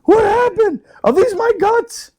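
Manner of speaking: high mock-wailing victim; dazed blast victim. Friend mocks a man splattered by a blast, high wail. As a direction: wail it high, hamming up the shock.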